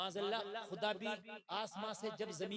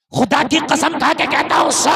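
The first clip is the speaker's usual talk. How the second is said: fiery preaching; 5 semitones higher, 22 dB louder than usual. Acted. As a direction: swear it to the crowd. Preacher at full force.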